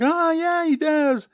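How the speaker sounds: high, delighted discovery; surprised realisation. Retold or imitated. Table players finding his podcast, surprised high voice. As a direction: sound like you just found it online.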